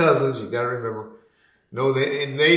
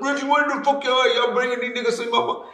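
imitating Ronald; 11 semitones higher, faster than usual. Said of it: "And he was like".

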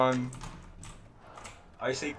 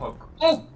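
हक said alone, much higher and louder; stress on हक; 10 semitones higher, 4 dB louder than usual. Real, not acted. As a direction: punch हक high to correct the word. Correcting captain to haq.

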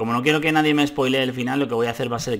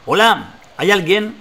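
calling out; 8 semitones higher, 7 dB louder, slower than usual. Acted. Traveller calling at inn door.

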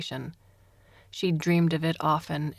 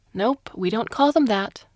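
louder, higher, quick and cutting; sharp correction; 5 semitones higher, 6 dB louder than usual. A narrator's reading. Interrogator correcting her prisoner's euphemism, sharp. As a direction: snap the correction.

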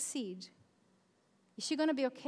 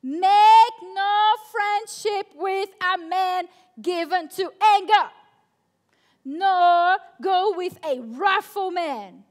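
slow, much louder scripture proclaimed; preaching reading; 4 semitones higher, 11 dB louder, slower than usual. Acted. Pastor proclaiming a proverb to the youth, full force. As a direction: thunder the verse at the room.

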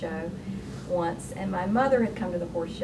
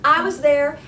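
higher and louder, calling from the back; woman calling out; 6 semitones higher, 9 dB louder than usual. Retold or imitated. Woman at the back of the library calling out to the author, higher and louder. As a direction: call out to the author across the room. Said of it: "she says".

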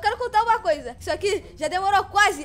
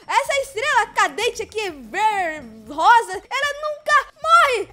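ordinary delivery

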